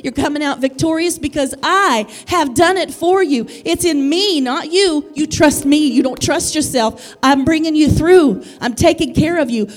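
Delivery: driving, emphatic sermon delivery; impassioned preaching. Real, not acted. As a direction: build the promise with fervour for the congregation. Preaches, voicing the Father's promise.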